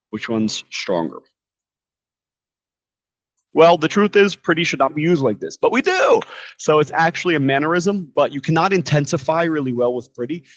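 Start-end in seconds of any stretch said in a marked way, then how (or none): 5.6-6.2 high-pitched cheeky squeal; louder-than-life falsetto concession; playful admission. Real, not acted. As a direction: pop way up high for a comic admission. Playful reversal of the rule.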